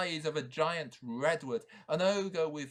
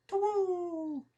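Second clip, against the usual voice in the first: short high owl hoot; a single high hoot; 5 dB louder than usual. Acted. Owl ending its call, high hoot.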